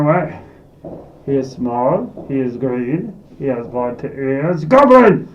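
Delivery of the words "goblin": sudden loud high shout of "Goblin!"; loud, high outburst. Acted. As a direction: blurt it out as a comic yell.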